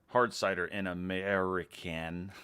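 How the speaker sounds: put-on slow, stretched-out American accent; exaggerated American accent. Reading a viewer's typed American accent to chat, exaggerated American. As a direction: drag out the American vowels, mock-style. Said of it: "supposed to be an American accent".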